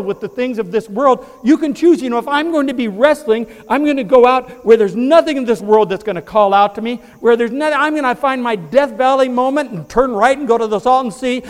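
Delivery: high, loud, slowing preacher's climax; preaching at full force. Acted. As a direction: lift the congregation with rising fervor. Preacher building again.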